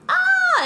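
very high, loud mock scream. Scientist with his bone showing, very high loud mock scream. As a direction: shriek way up top.

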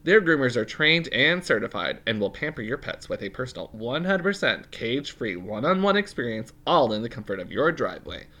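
bright energetic sponsor pitch; ad read. Acted. Co-host reads the groomer ad to listeners. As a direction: pitch it like a commercial.

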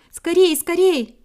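high, loud, stretched calls; urgent little bird calling out; hurrying others. A narrator's reading. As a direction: urge your friends on from ahead. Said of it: "и торопила их".

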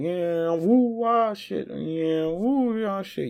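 high whooping swerving driver; reckless driver whoop. Pretend. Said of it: "he's like".

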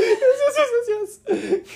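high, loud, laughing yes yes; laughing yeses. Retold or imitated. Teens agreeing eagerly with the cop, laughing high. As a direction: squeal yes through laughter.

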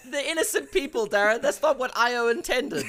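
high, loud laughing protest; cracking up while objecting; laughing outrage. Real, not acted. Mock outrage at innocent victims.

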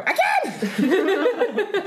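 high, loud, drawn-out squeal; excited scream. Retold or imitated. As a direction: reenact a shared shriek of delight. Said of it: "we just turn to each other and go".

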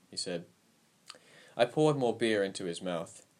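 ordinary delivery, nothing marked

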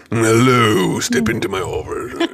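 lightly voiced ivory tower professor; a hint of a pompous academic welcoming; stuffy professor. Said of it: "this professor in the ivory tower".

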